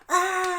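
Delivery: high, loud mock cheer; drawn-out high "aah" of a cheering mob; imitated crowd roar. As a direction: erupt like a crowd. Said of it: "everyone just erupts like".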